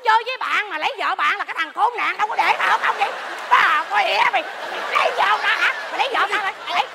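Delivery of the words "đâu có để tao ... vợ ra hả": normal talking voice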